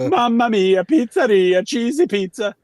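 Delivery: high, loud singsong Italian chef voice; jolly Italian pizza chef. Retold or imitated. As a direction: belt it like a cartoon pizza chef. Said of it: "I'll never forget that moment".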